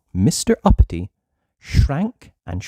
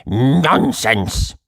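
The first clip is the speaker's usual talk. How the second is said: rude; 3 dB louder than usual. Acted.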